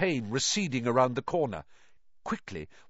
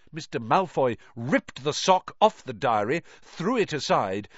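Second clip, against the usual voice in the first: ordinary delivery, nothing marked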